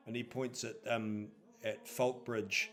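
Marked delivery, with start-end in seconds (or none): none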